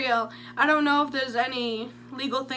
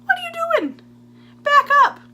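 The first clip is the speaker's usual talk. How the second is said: very high squealed back up; shrieking retort; 10 dB louder than usual. Retold or imitated. Narrator shrieks at Bob to back off.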